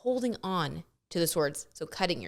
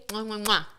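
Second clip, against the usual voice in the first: three quick smacking air kisses; blown kisses. Real, not acted. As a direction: blow kisses at the camera. Blowing kisses to viewers.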